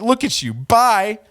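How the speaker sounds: mock dismissive rival. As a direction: mimic his snooty sign-off in a high voice. Mocking reading of rival's sign-off.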